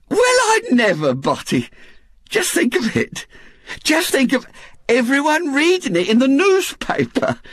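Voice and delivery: high, marvelling woman's voice; Missus Bott, excitable wife. A narrator's reading. Missus Bott marvels to her husband, thrilled. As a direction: gush in thrilled disbelief.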